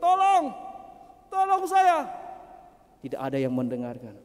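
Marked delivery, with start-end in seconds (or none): 0.0-2.1 high, loud shout from the pit; desperate acted cry for help. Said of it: "Dia berteriak".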